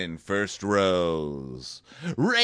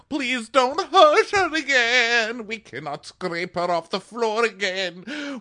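pleading family; 10 semitones higher than usual. Retold or imitated. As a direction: beg him through fake tears. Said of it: "They're like".

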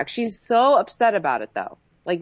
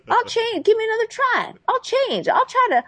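put-on higher, wheedling pleas to baby; mock abuser pleading; 7 semitones higher than usual. Imitating [name] begging [name] like [name]. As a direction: beg her back like a remorseful abuser. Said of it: "he goes into full on, like, [name]".

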